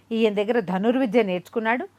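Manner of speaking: usual speaking voice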